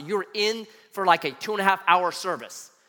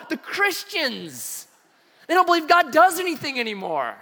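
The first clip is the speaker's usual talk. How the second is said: exasperated outburst; 9 semitones higher than usual. Retold or imitated. Son telling mom about campus Christians, exasperated. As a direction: stretch the first words, then protest high.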